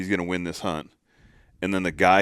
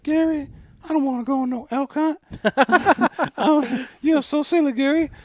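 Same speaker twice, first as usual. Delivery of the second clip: very high, hammed-up mocking wife voice; 6 dB louder than usual. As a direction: mimic the wife brushing off the elk hunt, sweetly. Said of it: "Is that how she said it?".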